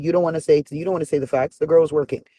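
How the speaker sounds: ordinary delivery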